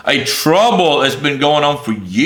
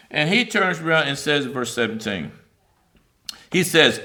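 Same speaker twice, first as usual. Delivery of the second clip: normal talking voice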